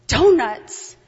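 loud, falling "Donuts?" in disbelief; "Donuts?" hit hard and loud, dropping at the end; incredulous. Retold or imitated. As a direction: blurt "Donuts?" as if it can't be true. Incredulous at her own journal.

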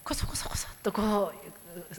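sneaky hushed whisper, slowed down; whisper demo. Shown as a demonstration. Interpreter showing what the angel does not do, whispering. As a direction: breathe it like a secret. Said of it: "ささやいていないんですね".

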